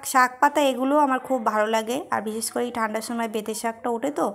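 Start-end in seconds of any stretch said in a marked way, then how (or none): 0.1-2.0 talking between bites; slightly muffled chewed words; mouth full, chewing. Real, not acted. Seen 2: Food put in mouth at -3; lips pursed, chewing while talking.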